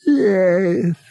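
high, loud alien yes; a giant alien's amused reply. A narrator's reading. Murg amusedly agrees with Han, high and loud. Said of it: "twitched with amusement".

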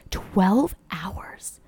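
sheepish whisper; whispered confession. Real, not acted. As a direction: whisper it, embarrassed. Confessing own screen time.

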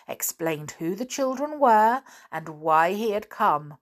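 ordinary delivery

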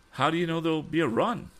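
put-on a keen young man asking fast; young inventor. A narrator's reading. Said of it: "asked the young inventor".

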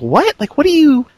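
high, incredulous what; baffled. Retold or imitated. Heroine reacting to the million dollar offer, incredulous. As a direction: retell her shocked confusion.

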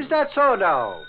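bristling at the insult; indignant retort. Acted. Reporter bristles at sergeant's jibe.

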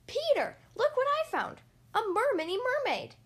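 put-on very high, thrilled child's call; excited calling out. A narrator's reading. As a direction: call your brother over to see your find. Calling brother to show discovery.

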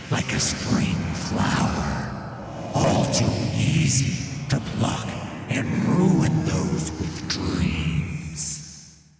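slow rasping ghostly whisper; a sinister wraith savouring torment; cold hissing layered whisper. Acted. Thresh's voice, the Wraith.

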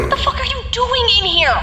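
furious shout. Acted. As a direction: yell it in shock and anger. Furious at the intruder.

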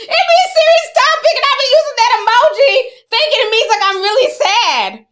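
very high, loud squeal through real laughter; squeaky-high words tumbling out through laughs; helpless laughter. Real, not acted. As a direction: crack up at your own mistake while talking. Cracking up at own emoji mistake.

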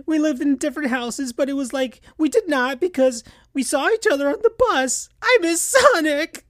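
put-on high young fox voice, a touch tearful; squeaky, sincere little boy speech; Tails' eulogy. Tails speaks at Sonic's funeral, high and mildly tearful. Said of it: "Tails started to cry more".

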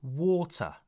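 WATER as 'waw-tuh', no final R; non-rhotic. Shown as a demonstration. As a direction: let Water end on 'uh'. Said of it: "You don't hear the R".

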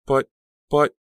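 halting, broken-off protest; sputter, stuck on the word. A narrator's reading. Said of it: "Danielle sputtered".

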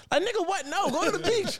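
incredulous. Retold or imitated. Mocking friend's trip plans.

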